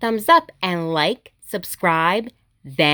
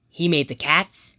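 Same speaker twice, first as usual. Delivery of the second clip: put-on reading to children; 2 semitones lower than usual. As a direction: read it gently to little kids, each line a small wonder. Reading picture books aloud.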